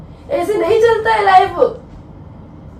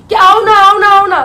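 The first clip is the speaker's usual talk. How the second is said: loud, mock-insistent coaxing; insistent retold pleading; 2 semitones higher, 7 dB louder than usual. Wife replays calling husband home, loud and insistent. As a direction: imitate nagging someone to come over. Acts out her nagging phone call.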